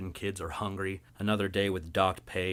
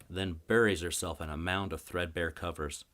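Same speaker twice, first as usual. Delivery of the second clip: ordinary delivery, nothing marked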